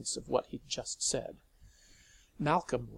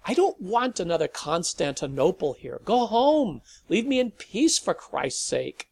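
higher, louder angry shouting; ranting; 6 semitones higher, 9 dB louder than usual. A narrator's reading. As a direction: chase them off, exasperated. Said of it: "Malcolm ranted".

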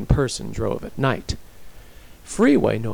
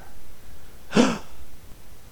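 sharp, shocked gasp; sudden airy intake of breath; 12 semitones higher, 4 dB quieter than usual. A narrator's reading. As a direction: suck in breath in horror. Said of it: "Tomas gasped".

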